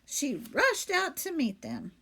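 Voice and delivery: ordinary delivery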